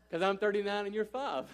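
mock-exasperated dad retort; exasperated parent. Parent answering child's constant why.

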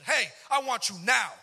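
loud, slow, high calling voice; a voice booming him awake; voice from above. Retold or imitated. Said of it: "I heard a voice that woke me up".

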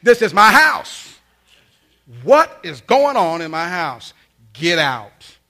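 very loud, slow, furious yell as mom; merciless angry mom. Retold or imitated. Mom throws son out of her house, slow furious shouting. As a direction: imitate mom thundering, each word stretched. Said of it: "Mom simply went from mercy to no mercy".

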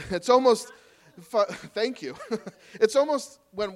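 chuckling through the words; laughing. Real, not acted. Laughing at the crowd's restlessness, laughing.

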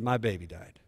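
low, softly voiced grieving father; retold grief. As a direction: quietly relay a father's loss. Retelling a grieving father's words.

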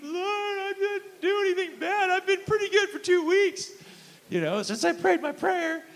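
high, loud tearful plea to God; high, loud and teary, confused why he's crying; weeping teen prayer. Retold or imitated. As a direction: reenact a sixteen-year-old sobbing his prayer. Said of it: "I just began to weep".

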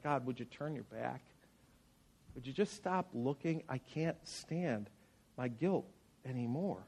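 soft, low plea for God to turn his back; hushed prayer. Retold or imitated. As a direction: beg God quietly to look away.

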